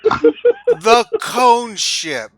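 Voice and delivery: very slow, drawn-out words; mock announcement. Mocking the cone ship name, very slow announcement. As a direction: stretch each word like a grand product name.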